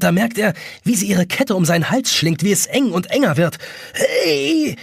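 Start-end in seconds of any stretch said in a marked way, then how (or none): 3.9-4.7 very high strangled hey; choked gasp. A narrator's reading. Jonas being strangled by the chain, a choked gasp. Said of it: "röchelt er".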